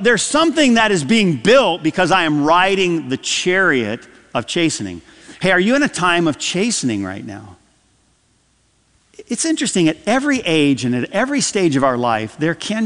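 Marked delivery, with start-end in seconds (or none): none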